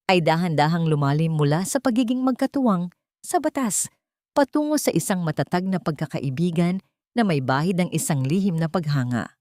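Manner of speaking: ordinary delivery, nothing marked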